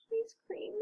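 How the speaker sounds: ordinary delivery, nothing marked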